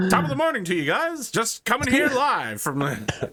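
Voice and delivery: smug businessman. Acted. NPC businessman boasting.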